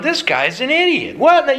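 high-pitched scoffing disbelief; incredulous, pitched well up; mock incredulous. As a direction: act out an onlooker's baffled ridicule. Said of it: "We're all sitting here laughing at him going".

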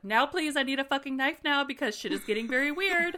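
high, loud, frantic plea; mock frantic demand. Bea begging Fitz for a knife, frantic loud plea. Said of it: "she's like".

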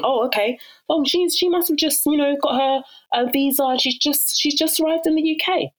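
high-pitched, knowing little aside; imagined onlookers' assumption. Retold or imitated. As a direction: imitate people making a kindly wrong assumption. Imitating people's assumptions about her.

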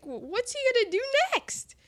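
high, fast eager question; breathless with suspense; excited. Retold or imitated. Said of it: "I'm like".

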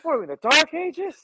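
very high-pitched mock outrage; outraged McCoy. Doctor appalled by primitive hospital medicine, mock outrage. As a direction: imitate an appalled doctor, voice shooting way up. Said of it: "that's when he says".

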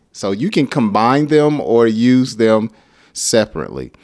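ordinary talk

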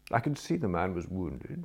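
usual speaking voice

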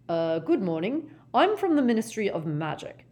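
lightly voiced hesitant Ministry man; nervous official. Ogden introducing himself to a hostile stranger, nervously. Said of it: "said Ogden nervously".

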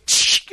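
short voiceless "tsh" crack; imitated neck snap. She snaps the guide's neck, imitated crack. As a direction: make a sharp hissed snapping noise.

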